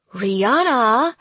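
growl. Acted. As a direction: growl the name in warning. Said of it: "she growled".

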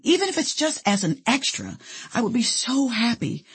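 wistful, eager appeal; hopeful pleading. A narrator's reading. Colleen pleads for a movie part.

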